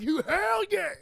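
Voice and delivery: high rowdy whoop, loud; rowdy biker yell. Retold or imitated. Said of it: "And they're like".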